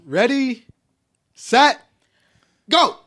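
loud countdown. Real, not acted. Starting the challenge timer.

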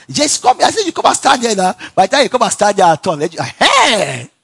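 high, loud shout across the room; yelling a name across the church, mock impatience; loud call. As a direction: call him over sharply, loud and high. Pastor shouting for someone.

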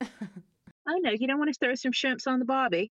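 high-pitched, badly faked Australian accent. Shown as a demonstration. Guest teasing an Australian host, rough Australian accent. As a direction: have a playful go at an Australian accent. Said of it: "show you my Australian accent".

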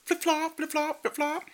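very high sing-song flip flop sounds; imitated flip flops. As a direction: mimic sandals slapping on the floor. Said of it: "the flippity flop of her flip flops".

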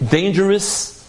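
ordinary talk

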